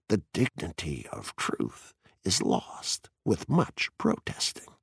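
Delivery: soft, slow, hushed quote; gentle whisper. A narrator's reading. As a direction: murmur it gently and slowly. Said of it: "And I whispered gently".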